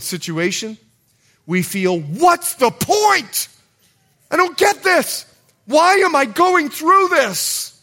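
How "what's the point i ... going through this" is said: despairing outcry. Acted. Despairing believers crying out to God.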